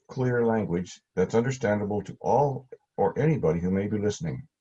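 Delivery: usual speaking voice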